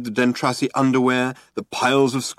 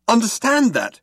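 high indignant shout; 11 semitones higher, 4 dB louder than usual. A narrator's reading. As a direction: yell it in disbelief. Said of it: "shouted Arthur".

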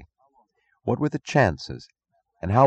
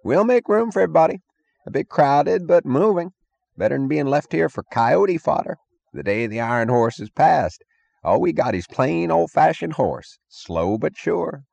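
sideshow barker; 9 semitones higher than usual. Acted. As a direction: cheerfully hawk it like a carny. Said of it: "the barker was yelling cheerfully".

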